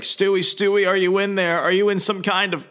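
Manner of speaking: high-pitched retold plea to a brother; trying to get through. Brother tries reaching Stewart inside suit, urgent calling. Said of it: "trying to get through to his brother".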